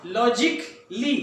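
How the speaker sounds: logically very slow as लॉजिकली, no "cal" syllable; slow correct pronunciation. Shown as a demonstration. Teacher models the word for students, very slowly. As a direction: stretch logically out in three beats. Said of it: "logically नहीं कहना".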